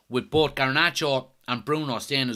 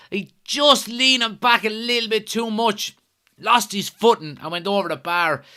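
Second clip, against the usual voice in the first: louder, higher live commentary as the chance builds; excited play-by-play; 7 semitones higher, 8 dB louder than usual. Real, not acted. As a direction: call the attack as it happens, rising to the shot. Live chance during the match.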